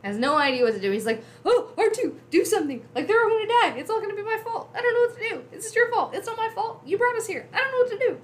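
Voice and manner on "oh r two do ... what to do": very high, frantic mock panic; C-3PO freaking out. Robot begging R2 to do something, hammed-up freak-out. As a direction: babble helplessly in a high panic, shifting blame. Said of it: "C three P O is freaking out".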